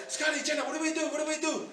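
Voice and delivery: mock panic. Church members phone the pastor for help, mock panicked. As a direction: imitate frantic pleading in a high, small voice.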